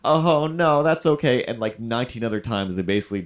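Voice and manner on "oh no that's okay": customer reply. Retold or imitated. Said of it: "customer reply".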